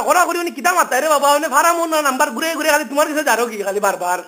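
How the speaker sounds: ordinary delivery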